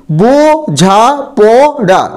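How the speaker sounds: বোঝাপড়া split slowly into its four syllables, বো-ঝা-প-ড়া. Shown as a demonstration. Teacher slowly splitting a word into syllables for students. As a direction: spell out বোঝাপড়া syllable by syllable for the class. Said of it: "দেখো এখানে চারটি দল রয়েছে".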